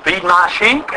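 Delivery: low, measured reply; Jesus answering. Retold or imitated. Jesus redirecting Peter to the sheep, low and steady. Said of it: "and the Lord Jesus".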